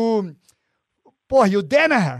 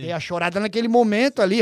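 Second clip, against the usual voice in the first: normal talking voice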